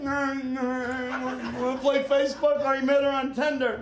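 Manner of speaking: slow, sing-song mocking of a distracted teen; mocking phone kid. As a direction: drawl it like a kid glued to the phone. Mocking oblivious phone kids.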